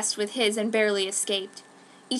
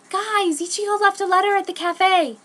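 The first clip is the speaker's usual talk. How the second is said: excited; 10 semitones higher, 7 dB louder than usual. Acted. Lettuce bursts in with news.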